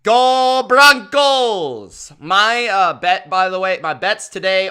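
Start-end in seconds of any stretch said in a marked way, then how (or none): 0.0-2.1 slow, drawn-out rallying cry; team cheer. Acted. Fan teasing a Bills viewer with a slow, drawn-out cheer. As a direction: stretch out the cheer for your team.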